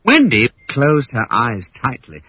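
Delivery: ordinary delivery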